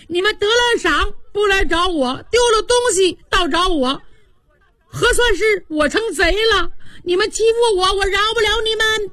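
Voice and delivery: put-on wailing, crying boy. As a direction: bawl out the grievance, sobbing. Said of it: "贾环哇的一声哭了". (拔高嗓门哭着嚷，越说越急)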